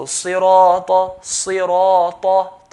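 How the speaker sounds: صراط drawn out slowly with a heavy ta; slow heavy recitation. Shown as a demonstration. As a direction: stretch the ra of صراط for two counts. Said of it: "Sira, ra, two counts".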